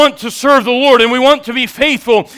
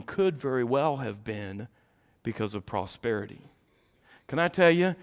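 hushed preaching; 13 semitones lower, 15 dB quieter than usual. Acted. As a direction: lean in and drop it low. Hushed solemn warning.

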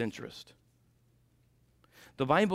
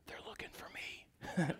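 soft unvoiced whisper breaking into a laugh; boy whispering to the caller, laughing; child's whisper; 4 semitones higher, 13 dB quieter than usual. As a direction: whisper the punchline like a hiding kid.

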